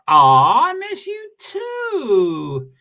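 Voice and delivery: high, slow, stretched-out tender aw; sing-song inflection. Shown as a demonstration. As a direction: croon it up high and drawn out. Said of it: "Am I doing voice inflections?".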